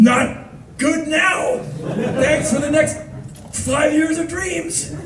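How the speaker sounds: high, slow laughing whine; wounded whine. Retold or imitated. Teller answering his ex, high and whiny. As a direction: whimper it through a laugh.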